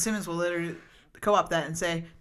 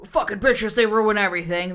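louder, scornful sneer of a sexist man; mock misogynist rant; 4 semitones higher, 8 dB louder than usual. Mocking how sexist fans blamed [name], louder, scornful. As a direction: spit it out like a bitter misogynist. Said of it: "instant patriarchal misogyny".

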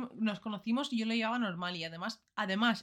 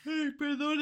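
apology mid-yawn, pitch up; 7 semitones higher, slower than usual. Real, not acted. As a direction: talk through a yawn. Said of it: "es que estoy bostezando".

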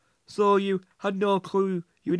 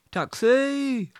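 calling out; 5 semitones higher than usual. Acted. Hailing a taxi.

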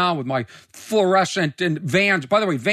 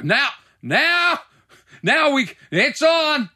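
much higher, slow and gleeful; excited; 11 semitones higher, much slower than usual. Real, not acted. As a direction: draw out "now" with rising glee. Locals back him up.

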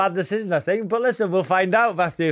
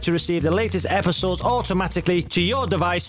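promo read; 3 semitones higher than usual. Acted. Podcast outro read.

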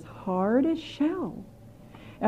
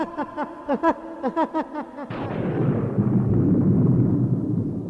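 witch cackle; 6 semitones higher, 3 dB louder than usual. Acted. Witch laugh after spearing.

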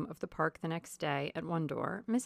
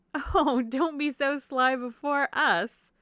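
high, loud, drawn-out teasing; playful society lady; teasing Mrs. Palmer; 7 semitones higher, 9 dB louder, much slower than usual. A narrator's reading. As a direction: tease her in a gushy, raised voice.

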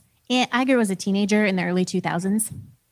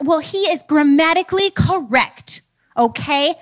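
slow, louder, higher defensive insistence; defensive retort; 4 semitones higher, 5 dB louder, slower than usual. Retold or imitated. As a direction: dig in your heels, a bit pompous. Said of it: "you get defensive when someone calls you out".

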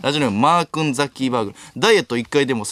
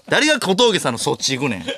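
normal talking voice

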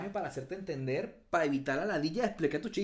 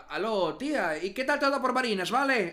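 loud, high-pitched mock phone call to an aunt; imitación burlona; 5 semitones higher, 6 dB louder than usual. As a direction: ham up a cheery chat with your aunt. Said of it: "Pero otra vez que comience". (imitación burlona de llamada a la tía, alto y agudo)

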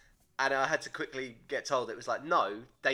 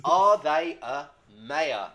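slow, louder announcer reading; slow title reading; 7 dB louder, much slower than usual. Shown as a demonstration. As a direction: spell the title out slowly like a host.

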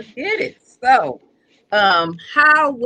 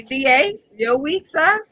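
normal talking voice

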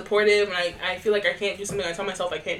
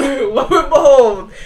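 booming laughing lo and behold; hammed-up grand announcement; laughing proclamation; 5 semitones higher, 12 dB louder, slower than usual. Echo with laugh.

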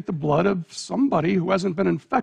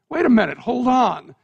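sudden raised plea, higher and louder; alarmed protest; 5 semitones higher, 5 dB louder than usual. Acted. Stop them, voice jumping up. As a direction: parent cries out as their boy is grabbed, louder. Parent protests as son taken.

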